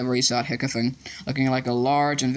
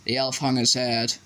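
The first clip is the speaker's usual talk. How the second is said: plain reading voice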